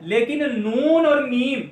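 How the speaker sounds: ordinary delivery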